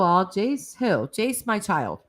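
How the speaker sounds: ordinary delivery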